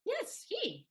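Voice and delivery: high, emphatic insistence; pushing him to understand; insistent emphasis. Acted. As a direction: drive the point home high. Susanna pressing the point hard.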